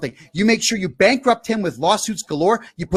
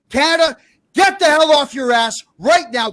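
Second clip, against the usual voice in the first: angry command; 6 semitones higher, 4 dB louder than usual. Real, not acted. Ranting at the country.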